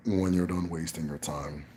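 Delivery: a hint of a stern elder summoning; granduncle's voice. Granduncle ordering the mother to bring the child, low and soft. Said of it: "he talks like this".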